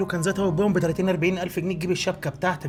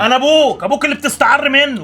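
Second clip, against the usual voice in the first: loud, high, wounded outburst; hurt father shouting at the son who is ashamed of him; angry shout; 8 semitones higher, 10 dB louder than usual. Acted. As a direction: explode at your ungrateful son. Father finally explodes at son.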